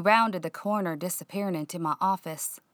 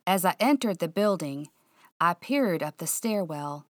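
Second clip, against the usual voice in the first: usual speaking voice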